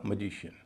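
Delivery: "मैजिशियन" opening on a short "muh"; light first vowel. Shown as a demonstration. Teacher models the word for learners, light first vowel in "मैजिशियन". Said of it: "ए को बोला जाएगा अ".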